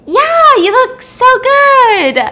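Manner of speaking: very high, loud, slow gushing; gushing friend. Retold or imitated. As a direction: gush over her like an old friend. Coworker gushes again.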